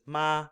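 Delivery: "ma" on one high, even pitch; steady high first tone on "ma"; high level tone. Shown as a demonstration. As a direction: hold "ma" high, no movement. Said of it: "staying high, not veering from that".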